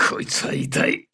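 voiceless, strained groan of words; pained. Acted. Soldier admits that one hurt, strained and pained. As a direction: squeeze it out through the pain.

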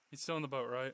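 ordinary talk, nothing marked

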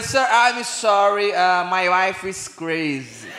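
slow careful tourist English; slow broken English. Retold or imitated. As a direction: spell it out slowly to the stranger. (inglês lento de turista)

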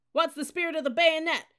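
high, loud drill-leader bellow. A narrator's reading. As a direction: bellow the question at the cadets. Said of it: "the upperclassman bellowed again".

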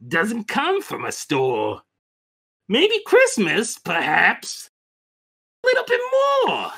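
slow, rising wonder with high swoops; Grinch's dawning realization. Acted. Grinch realizing what Christmas means, slow wondering voice. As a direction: draw out each thought as it dawns.

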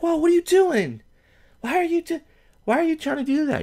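very high-pitched mock protest; mock startled woman. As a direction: squeal it like a woman fending off a kiss. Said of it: "she's like".

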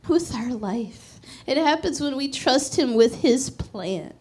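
ordinary talk

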